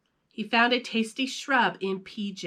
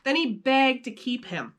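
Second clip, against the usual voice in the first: ordinary delivery, nothing marked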